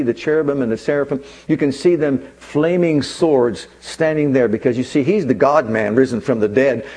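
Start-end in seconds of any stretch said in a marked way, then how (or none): none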